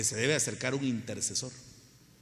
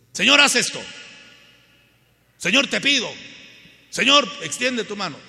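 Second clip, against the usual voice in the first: demanding prayer; 8 semitones higher, 8 dB louder than usual. Retold or imitated. Mock demanding prayer to God.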